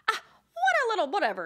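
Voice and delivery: high, loud, fast mocking mom voice; loud high squeal. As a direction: mimic mom squealing at the kid. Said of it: "She's like".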